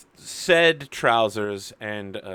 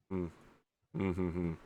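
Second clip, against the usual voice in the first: terse murmur; 6 semitones lower, 11 dB quieter than usual. Acted. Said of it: "And he's just like".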